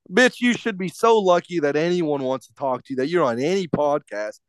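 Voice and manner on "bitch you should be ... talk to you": usual speaking voice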